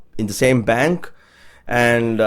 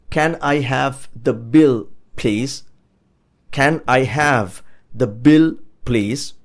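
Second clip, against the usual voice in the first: "Can I have the bill, please" word by word, with pauses; slow model; slower than usual. Shown as a demonstration. Teacher modelling asking for the bill after eating, slow and spaced out. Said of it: "you could say".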